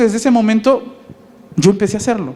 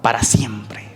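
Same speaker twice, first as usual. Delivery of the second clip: breathy whisper, much lower; whispered answer; 9 semitones lower, 5 dB quieter, slower than usual. Shown as a demonstration. Preacher answering his own question, whispered. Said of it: "¿Para cuándo?".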